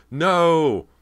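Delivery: long, high, loud cry; drawn-out anguished scream; terrified wail. A narrator's reading. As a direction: wail it out in horror. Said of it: "her own terrified wail".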